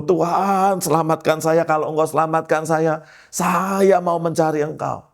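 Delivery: high, insistent retold plea; acted-out begging for rescue; earnest desperate prayer. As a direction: bargain with God, pitched well up. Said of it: "Jadi saya sungguh-sungguh".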